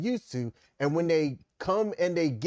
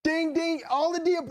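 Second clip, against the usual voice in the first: much higher, gleeful cheer; excited ding ding; 3 dB louder than usual. Real, not acted. Cohost cheering her partner's point, much higher and gleeful. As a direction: ring the bell for a winning point.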